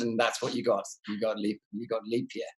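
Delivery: usual speaking voice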